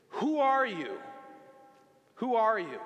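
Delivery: a father shaking his son, pleading shout; distraught dad shouting. Retold or imitated. Father confronting his son, loud anguished repeat. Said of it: "he said".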